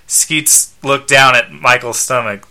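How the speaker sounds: ordinary delivery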